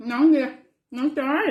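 calling out. Real, not acted. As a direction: call across the yard. Calling out to kids.

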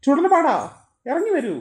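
an astrologer loudly summoning the demon; summoning call. Acted. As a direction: call the spirit out of hiding.

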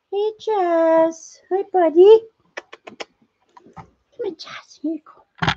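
high coo, softening to a near-silent coax. Real, not acted. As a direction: coax the little pet gently. Cooing to pet chipmunk.

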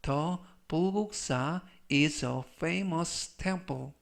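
slow, separated phrases for learners; slow model reading. Shown as a demonstration. Teacher models the temple sentence for learners, slow model reading. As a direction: read it slowly as a language model sentence.

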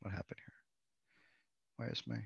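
whispered aside to self. Real, not acted. Presenter fumbling with the call, whispering to herself. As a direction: drop to a breathy whisper, half to yourself. Muttering to self over tech.

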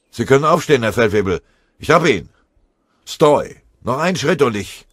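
high, loud shout to a superior at a distance; shouted soldier's report and warning; calling out. A narrator's reading. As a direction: yell across the clearing. Soldier calls over from the undergrowth.

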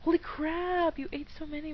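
high mock exclamation; put-on high astonished voice; imitating mom. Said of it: "my mom says".

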